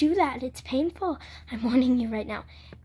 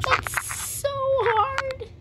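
slow high crying whimper; pained whimper; 12 semitones higher, much slower than usual. Real, not acted. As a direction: stretch it out in pain. Pain while picking up.

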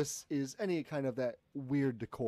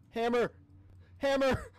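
high, loud repeated call; mock-urgent raised shout; 9 semitones higher, 5 dB louder than usual. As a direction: call out for a lost thing. Calling the glitched hammer.

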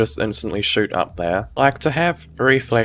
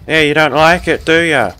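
loud, higher jeering taunt; aggressive, raised-voice gloating; 5 semitones higher, 7 dB louder than usual. Real, not acted. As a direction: crow at the enemy you are hitting. Taunts opponent while firing back.

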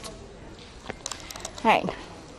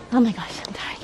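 breathless whisper; 3 dB quieter than usual. Real, not acted. Climbing stairs, exhausted.

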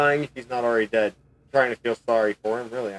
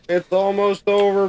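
slow, loud, drawn-out call; loud rallying call; 9 semitones higher, 6 dB louder, slower than usual. Acted. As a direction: call it out to the boys.

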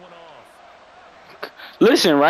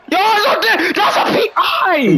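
high, loud protest at the ref; furious fan shouting at the screen; outraged shout; 4 dB louder, slower than usual. Real, not acted. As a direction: yell it like the call was robbed. Outraged at missing penalty call.

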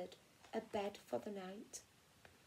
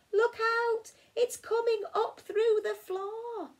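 alarmed cry; 11 semitones higher, 7 dB louder than usual. Acted. Animals alarmed by moving floorboard.

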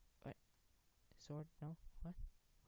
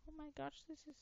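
very high put-on voice; different voice; faster than usual. Gamer trying out a different voice, pitched very high. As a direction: squeak it out far above normal pitch. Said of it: "I wanna talk in a different voice".

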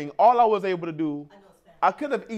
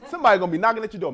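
ordinary delivery

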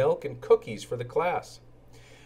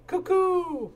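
high bright cuckoo; cuckoo call. Acted. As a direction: call it like a clock bird. Bell goes cuckoo.

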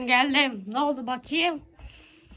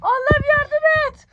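panicked pleading; 10 semitones higher than usual. Pretend. Mother pleads in pretend disaster.

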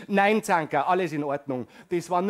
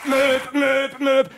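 high loud beeping alarm; mock fire alarm sound; alarm imitation; 7 semitones higher, 8 dB louder, much slower than usual.

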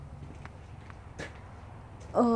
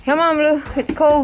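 calling out; 5 semitones higher, 8 dB louder than usual. Real, not acted. Calling the dog in.

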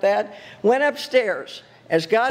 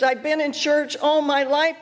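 usual speaking voice

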